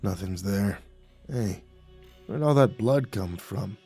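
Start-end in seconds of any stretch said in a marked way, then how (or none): 0.0-0.8 low, soft, dazed echo; muttered. A narrator's reading. Dazed guard echoing the nymph, muttering. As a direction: mutter it as if persuaded. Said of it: "away from us and muttered".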